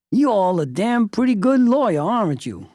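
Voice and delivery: high, loud imitated Southern drawl. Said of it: "in his southern drawl".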